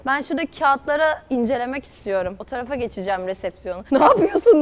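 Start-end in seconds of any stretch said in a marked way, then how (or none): 3.9-4.6 laughing. Real, not acted. Teasing friend, laughing.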